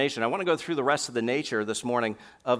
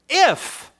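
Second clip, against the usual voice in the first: "if" hit far higher and louder, then a pause; stress on if; 10 dB louder than usual. Shown as a demonstration. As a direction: punch the word "if" hard. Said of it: "I'm emphasizing that for a point".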